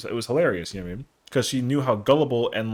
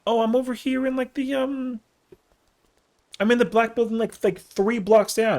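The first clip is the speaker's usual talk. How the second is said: much higher, nervous explaining; high flustered voice; 10 semitones higher than usual. Retold or imitated. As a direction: pipe it up, flustered, slowing at the end. Telling her his dorm.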